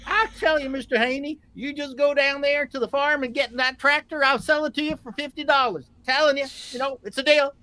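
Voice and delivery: a hint of a folksy hillbilly pitchman; hillbilly accent. Selling a tractor to Tom in a light hillbilly accent. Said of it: "best Canadian hillbilly accent".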